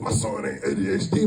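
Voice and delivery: very low, put-on parental voice; parent in denial. Dad mimics typical parents denying diagnosis, deep voice. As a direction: drop deep to deny it.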